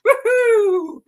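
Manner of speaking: high whooping cheer; woohoo cheer. Real, not acted. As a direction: whoop like a fan. Cheering after quote.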